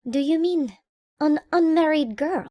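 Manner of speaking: relieved, eager question; curious relief. A narrator's reading. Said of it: "great curiosity and sincere relief in his voice".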